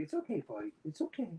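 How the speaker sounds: soft, hushed reassurance to a scared dog; calm the pooch down gently; scene: owner soothes his frightened dog, soft and hushed; soothing the dog. Real, not acted. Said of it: "Sorry, I'm kinda yelling. I'm scaring my pooch".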